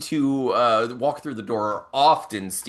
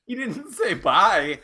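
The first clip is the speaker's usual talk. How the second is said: laughing disbelief; 6 semitones higher, slower than usual. Retold or imitated. Boss baffled aside.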